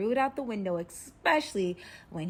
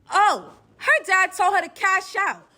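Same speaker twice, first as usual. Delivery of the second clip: high, drawn-out indignant discovery; loud realisation; 10 semitones higher, 11 dB louder, slower than usual. Retold or imitated. Captive realising the dad wants reward money, loud and high. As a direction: stretch it out high and loud as it dawns.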